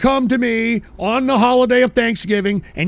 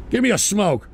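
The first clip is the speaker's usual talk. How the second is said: ordinary talk, nothing marked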